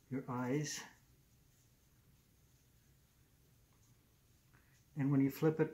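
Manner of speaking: muffled by fabric covering; speaking through balaclava; through a face mask. Real, not acted. Seen 3: Fabric balaclava covers mouth and nose throughout all labelled frames.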